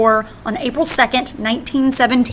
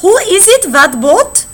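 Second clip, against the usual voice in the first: lightly voiced sailor calling out, high and loud; a hint of a gruff sailor hailing another boat; German sailor; 8 semitones higher, 6 dB louder, slower than usual. Teacher reads the cartoon's German sailor, called out high and loud. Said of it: "the German sailor says".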